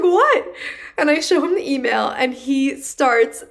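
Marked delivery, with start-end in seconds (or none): 0.0-0.5 much higher, louder startled question; a boss caught off guard, snapping up in pitch; boss's surprised what. Retold or imitated. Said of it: "He's like".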